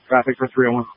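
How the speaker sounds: normal talking voice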